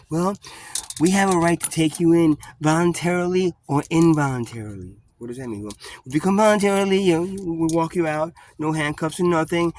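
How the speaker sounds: coaxing cop. Retold or imitated. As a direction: imitate the cop making it sound easy.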